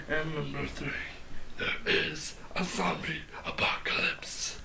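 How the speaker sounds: hushed, ominous whisper. Acted. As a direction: breathe it out like a secret. Dramatic reveal of apocalypse.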